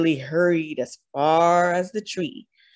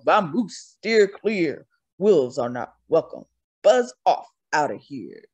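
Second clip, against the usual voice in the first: gruff ram voice; 3 semitones lower than usual. A narrator's reading. Ram ordering the wolf out, in a gruff voice. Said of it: "bellowed Rambo".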